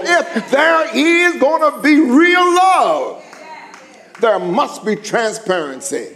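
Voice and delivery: loud, rising preacher's shout; preaching. Acted. Preaching at full force.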